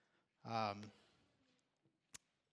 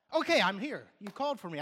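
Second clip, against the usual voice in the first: louder, higher, ready response; eager answer; 5 semitones higher, 10 dB louder than usual. Acted. God responding to his child's call, eagerly. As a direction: jump in at once as if just called.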